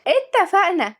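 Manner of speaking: put-on high child voice with falling end; squeaky, decisive kid's reply; agreeing little girl. A narrator's reading. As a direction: chirp agreement like a small child.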